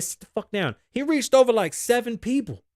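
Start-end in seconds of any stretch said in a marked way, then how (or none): none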